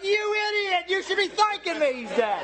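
high, laughing shout at the rooster; mock yell. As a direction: yell at the dumb bird, half laughing. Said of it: "And I'm like".

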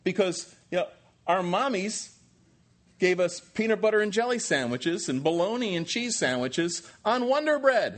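impassioned pulpit preaching; fired-up preaching. Acted. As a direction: preach it hard, rising with conviction. Preaching at full force.

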